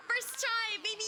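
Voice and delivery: high triumphant brag. Real, not acted. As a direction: crow about winning. Celebrating first-try victory.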